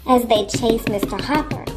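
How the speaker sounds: plain reading voice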